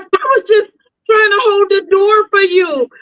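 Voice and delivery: high, whining plea of innocence; imitating tearful excuse. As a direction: protest innocence in a high, near-crying voice. Frightened man explaining himself, imitated.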